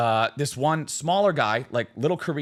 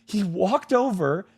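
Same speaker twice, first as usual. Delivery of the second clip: higher, slow horrified account; mock terror; 7 semitones higher, slower than usual. Frightened smaller guy recounting the night, mock panic. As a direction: imitate a rattled witness reliving it. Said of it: "fear and terror in his eyes".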